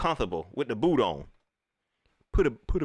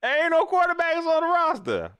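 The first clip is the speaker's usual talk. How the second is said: high mock shout; 6 dB louder than usual. Repeats roster complaint louder.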